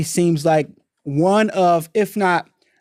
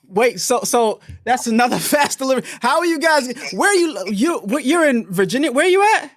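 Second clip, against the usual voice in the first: excited; 7 semitones higher than usual. Real, not acted. Host excited by caller's location.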